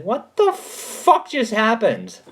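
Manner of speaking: stunned disbelief. Retold or imitated. Recalling the stranger who flashed a light at him, stunned and slow. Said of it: "And it's just like".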